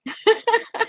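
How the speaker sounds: high laugh. Real, not acted. Laughs reading chat.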